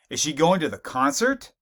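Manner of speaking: going stressed, question rising at the end; stress on going with a rise. Shown as a demonstration. Teacher showing how stress shifts meaning, rising stress on going. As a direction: lean on going and let the question lift in surprise. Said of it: "So in terms of emphasis".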